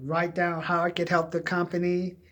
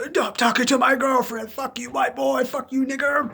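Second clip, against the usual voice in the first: higher mock tough-guy taunts; bar fight taunts; 7 semitones higher than usual. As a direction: bark like a drunk picking a fight. Bar fight insults retold.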